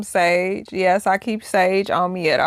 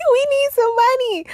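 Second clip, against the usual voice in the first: laughing plea. Pretend. Begging kid for lottery numbers.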